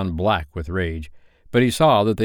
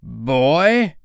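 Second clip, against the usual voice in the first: higher, drawn-out question; questioning pirate captain, pitched up; probing captain; 7 semitones higher, 4 dB louder than usual. A narrator's reading. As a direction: raise the guess expectantly. Hook guesses with rising hope.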